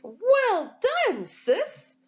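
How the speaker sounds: high, bright cheer with a laugh; big sister delighted with her little sister's memory; delighted praise. A narrator's reading.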